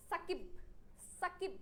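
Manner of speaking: সাকিব cut off right on ব, no vowel after; stopped final consonant. Shown as a demonstration. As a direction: stop dead on the ব of সাকিব. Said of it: "ব বলে থেমে যেতে হচ্ছে". (সাকিব-এর ব-তে থেমে)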